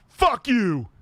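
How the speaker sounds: high, loud mock yell; explosive put-on curse; Tourette's Guy. Fan quoting the dad's outburst, shouted impression. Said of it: "Tourette's guy".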